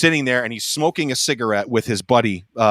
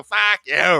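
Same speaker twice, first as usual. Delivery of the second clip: higher, snappy imitated comeback; mock angry retort; 6 semitones higher than usual. Star being needled by drum shop guys, mock angry retort. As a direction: throw it back as a mock insult.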